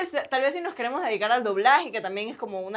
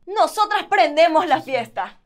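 loud, high, slowly stretched "Nosotras prendemos la fiesta"; stage line, projected; 5 semitones higher, 6 dB louder, slower than usual. Retold or imitated. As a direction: belt out the musical line slowly, higher and louder. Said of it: "Y yo decía".

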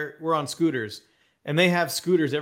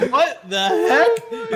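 startled onlookers; 8 dB louder, much slower than usual. Retold or imitated. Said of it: "people are, like".

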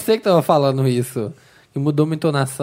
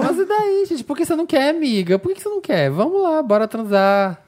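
much higher, whiny mock plea; mock Aries voice; 10 semitones higher than usual. Friend mocking Maria's pushy Aries side, squeaky and high. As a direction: squeal it like a spoiled girl begging. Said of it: "Ah, mas eu quero".

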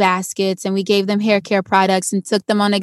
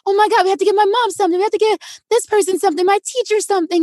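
high, fast, breathless-sounding rush of ideas; frantic excitement; 10 semitones higher, much faster than usual. Retold or imitated. Kid running through Target for gifts, frantic excitement. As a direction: re-enact a kid racing to shop for everyone.

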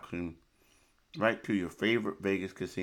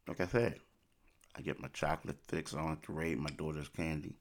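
usual speaking voice

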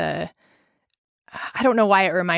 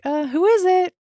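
higher, hesitant imitated question; imitated wary student; 12 semitones higher, 4 dB louder than usual. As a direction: call it through the door, high and unsure. Student calls through barricaded door.

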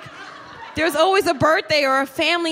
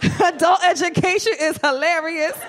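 much higher, loud and laughing, slowed on laughing; mock audience voice, laughing; 7 semitones higher, 3 dB louder than usual. Voicing a laughing audience member, loud and giggly. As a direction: ham up a cackling fan who finds it all funny. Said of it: "she's like".

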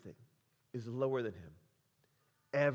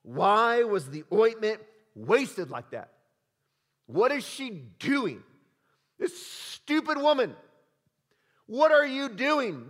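slow, higher, louder indignant outburst; acted anger; 5 semitones higher, 6 dB louder, slower than usual. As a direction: scold her in disbelief. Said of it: "They are angry".

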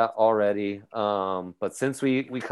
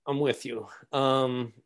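ordinary delivery

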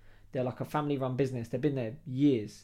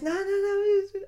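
high mock protest; 6 dB louder, much slower than usual. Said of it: "He goes".

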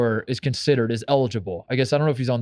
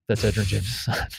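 laughing. Real, not acted. Laughing at the surprising name.

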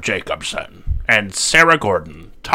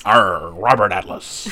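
slow growled pirate; 3 dB louder, slower than usual. Acted. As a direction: drawl it like a pirate. Pirate aside on spokesperson name.

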